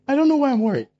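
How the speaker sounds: mimicking a church member; high whiny worrier. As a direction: whine it like a helpless worrier.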